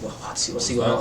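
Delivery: very soft, confused mumble; hushed overlapping-crowd mutter; crowd murmuring. Acted. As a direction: murmur it among bystanders.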